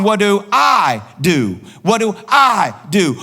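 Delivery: "I" drawn out long, set apart before "do"; slow it down and lean hard on "I"; stress on I. Shown as a demonstration. Preacher exposing self-focused questions, stress on "I". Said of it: "Then the emphasis is on".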